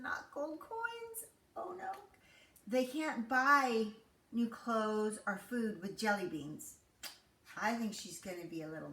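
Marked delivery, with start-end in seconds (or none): none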